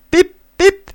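put-on high, loud baby-bird peep; chick peeping. A narrator's reading. Newly hatched duckling's first cry, high loud peeping. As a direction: squeak it out like a newborn duckling. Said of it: "dit le petit en roulant dehors".

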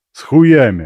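loud crude retort; rude shout. Acted. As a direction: throw it back hard. Guard snaps back rudely.

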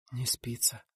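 whispered, sleepless in bed; soft whisper. Acted. Rita whispering to her lover at night. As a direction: breathe it in the dark. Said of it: "тихонько пробормотала Рита".

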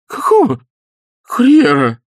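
high, loud horrified cry. Acted. Изнуренков horrified at the courier, high alarmed cry. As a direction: gasp it out in alarm. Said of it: "ужаснулся Изнурёнков".

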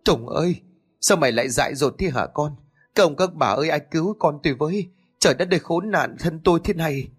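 gào khóc gọi con trong tuyệt vọng. A narrator's reading. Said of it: "tiếng khóc than nghẹn ngào thảm thiết". (người mẹ mất con khóc than thảm thiết, giọng cao vút)

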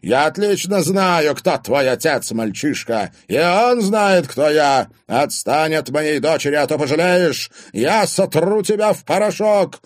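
loud, high-pitched menacing tirade; angry threat. A narrator's reading. Father threatens boyfriend over his daughter, angrily. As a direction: threaten to crush him.

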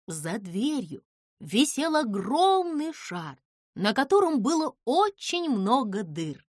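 ordinary delivery

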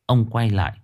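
ordinary delivery, nothing marked

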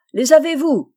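high, loud call through cupped hands; yelled question. A narrator's reading. Notary calls to the unseen hunters, yelling. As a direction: holler it across the ravine.

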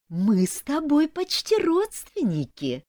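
high, slow, oily sweetness; unctuous hiss. A narrator's reading. Rival in-law to the bride, unctuous and sly. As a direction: ooze false kinship in a drawn-out high voice. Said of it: "елейно прошипела она".

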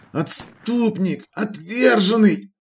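high, slow venomous muttering; muttering curses. A narrator's reading. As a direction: spit out the curses hatefully. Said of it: "бормотала она".

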